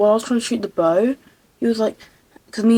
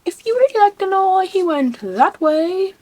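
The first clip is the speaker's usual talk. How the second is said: teasing; 9 semitones higher, 6 dB louder than usual. Acted. Teasing, mock-dramatic line.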